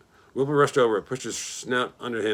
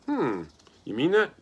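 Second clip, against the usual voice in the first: sly; 5 semitones higher, slower than usual. A narrator's reading. Greedy rat tempted by food.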